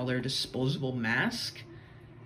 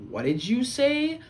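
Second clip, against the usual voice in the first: slow, high, rising challenge; mock outrage, drawn out and pitched up; affronted Karen; 9 semitones higher, 4 dB louder than usual. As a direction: imitate an offended woman daring someone to repeat it.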